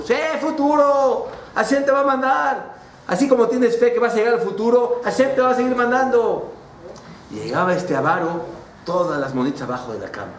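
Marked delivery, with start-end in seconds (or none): 0.0-6.5 higher, teasing, playful voice; mocking big brother urging fun; carefree brother. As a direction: tempt him to spend it all, laughing at him. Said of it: "su hermano se reía de él".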